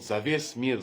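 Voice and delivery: ordinary talk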